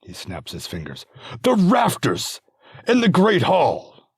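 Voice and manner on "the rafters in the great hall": much higher and louder, slow triumphant realisation; the lord proudly shouts out his sudden idea; eureka. Acted. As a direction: lord realising where the toy is. Sudden idea, snaps fingers.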